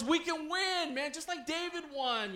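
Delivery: high-pitched imitation of a pep talk; rally the team like a preaching coach; motivating coach. Coach rallies losing players at halftime, high urgent pep talk. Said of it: "he would tell us".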